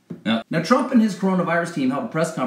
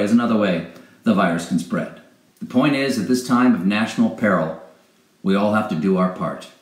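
ordinary talk

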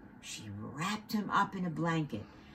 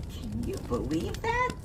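amazed question. A narrator's reading. Narrator asks children, excited.

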